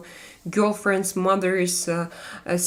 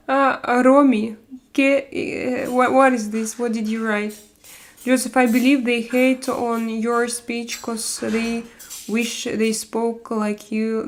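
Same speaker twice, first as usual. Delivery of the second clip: ordinary delivery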